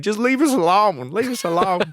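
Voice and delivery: high-pitched mock plea; imitating pleading woman. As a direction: plead like a distressed wife, mockingly. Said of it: "she keeps on saying".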